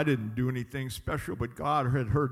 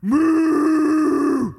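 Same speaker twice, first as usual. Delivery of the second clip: high, loud mooing cow; loud hungry mooing; 11 semitones higher, 10 dB louder, much slower than usual. Retold or imitated. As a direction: moo like hungry cows in the field. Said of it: "The cows in the field were going".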